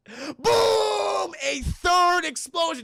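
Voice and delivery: excited shout. Retold or imitated. Third explosion, rising high.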